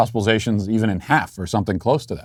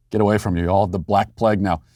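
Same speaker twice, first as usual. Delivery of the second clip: ordinary delivery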